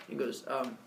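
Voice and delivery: usual speaking voice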